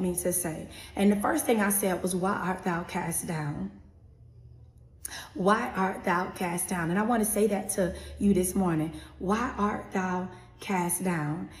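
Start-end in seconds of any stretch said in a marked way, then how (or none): 2.2-11.5 solemn recitation. Acted. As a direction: recite it as a prophetic word. Shares a word from the Lord.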